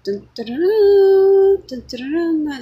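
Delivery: sung-out dun dun dun, jumping high and loud on the middle one; mock trumpet fanfare. Preacher imitating trumpets sounding for war, loud fanfare. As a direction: belt it like a brass fanfare announcing war.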